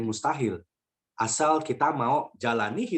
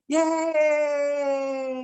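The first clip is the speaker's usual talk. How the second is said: high, drawn-out gleeful yay; child's cheer; 11 semitones higher than usual. Retold or imitated. Child behind him enjoying turbulence, cheering. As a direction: imitate a little kid thrilled by the drop. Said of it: "di belakang ada suara gini".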